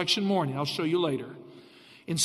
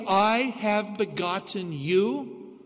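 slow emphasis; much slower than usual. Shown as a demonstration. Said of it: "Now you see that phrase".

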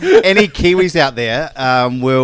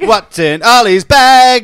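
announcer voice; 7 semitones higher, 7 dB louder, slower than usual. Acted. Echoing segment title slowly.

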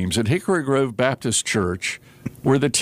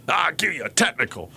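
higher, showy mock ref call; snotty ref; 4 semitones higher than usual. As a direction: act out the careless referee, lightly. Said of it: "do the tee".

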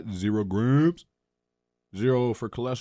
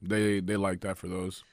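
ordinary delivery

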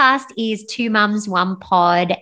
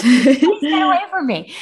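laughing; 7 semitones higher, 4 dB louder than usual. Real, not acted. Retold refusal said laughing.

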